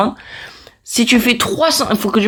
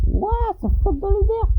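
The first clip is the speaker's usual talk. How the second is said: amazed; 13 semitones higher than usual. Real, not acted. Amazed at something floating.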